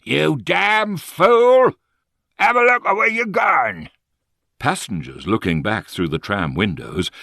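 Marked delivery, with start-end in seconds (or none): 0.0-3.9 angry Cockney yell. A narrator's reading. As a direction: yell at the fool in the road in Cockney. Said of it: "he heard the tram driver yelling at him again".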